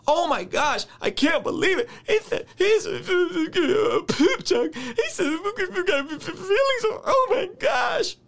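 high, shrieking fake outrage; hammed-up wail of a touchy church member; mock offended churchgoer. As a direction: play the scandalized pearl-clutcher. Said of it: "That's the energy some of y'all be on".

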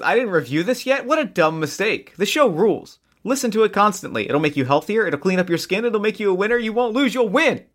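mounting mock-infomercial excitement; hyped review reading. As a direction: read it like an over-the-top testimonial. Hammed-up reading of glowing review.

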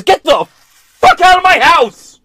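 high, loud, slow furious yell; angry shout. Retold or imitated. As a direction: roar him out of the house. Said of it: "he goes".

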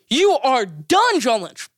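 slow, punchy call-out to the GM; slow emphatic call-out. Real, not acted. Calling out the GM.

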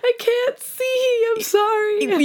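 high-pitched, drawn-out tearful wail; hammed-up sobbing apology, squealed high; mock crying. Apologizing to the date through streaming eyes, mock crying. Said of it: "I am crying so much".

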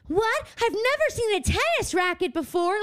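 high, wide-eyed mock little orphan; mock Annie. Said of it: "She's literally like".

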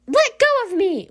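high-pitched struggling cry; acted protest. Girl being held outside, high-pitched alarmed protest. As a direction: cry out as if grabbed.

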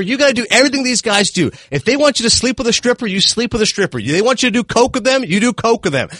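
high, fast scolding rant; mock tirade. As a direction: tear into him like an angry mentor. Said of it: "Leguizamo's torching Cranston".

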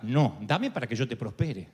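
low, calm correction; a hint of a deep God voice.

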